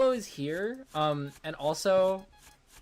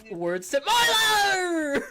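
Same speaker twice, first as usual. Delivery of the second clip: high, loud slow shout; mock shout; 7 dB louder, slower than usual. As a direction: yell it like a stream title. Shouted parody stream name.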